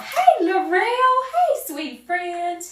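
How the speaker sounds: high, bright sing-song hello to a friend; excited greeting. Real, not acted. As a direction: greet her like a long-lost friend. Greeting a viewer in chat.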